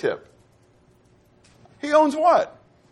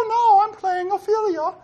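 actor voicing Ophelia. Shown as a demonstration. Preacher demoing boy actor playing Ophelia, high falsetto. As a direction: put on a high Juliet-style falsetto. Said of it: "and I speak like this".

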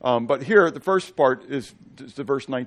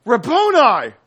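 high, loud cry of recognition; joyful cry; 10 semitones higher, 9 dB louder, slower than usual. Acted. Mary recognizing Jesus at the tomb, cries out high and loud. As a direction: call out Rabboni in sudden joy, loud.

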